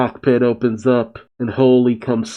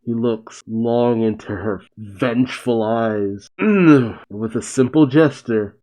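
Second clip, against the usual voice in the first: ordinary talk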